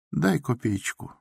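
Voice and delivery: high, soft, shivering beggar's plea. A narrator's reading. A beggar asks the doctor for a coin, shivering. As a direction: beg for a kopeck, cold and smiling. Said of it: "дрожа от холода и улыбаясь".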